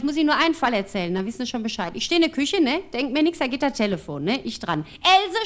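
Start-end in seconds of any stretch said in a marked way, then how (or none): 5.0-5.5 high, loud call down the phone; high-pitched mock shout; shouting grandma. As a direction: yell the name like a shrill-pitched old granny. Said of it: "schreit sie, die schreit immer so".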